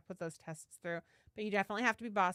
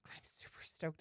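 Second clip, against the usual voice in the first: excited whisper; 5 semitones lower, 10 dB quieter than usual. Real, not acted. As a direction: share the thrill as a secret. Excited aside about box set.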